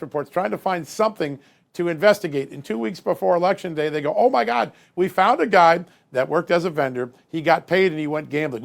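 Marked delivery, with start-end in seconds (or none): 4.2-8.6 louder, higher mock alarm; mock excited discovery. Analyst mimics FBI agents finding a vendor, mock excitement. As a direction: imitate investigators thrilled at a flimsy find.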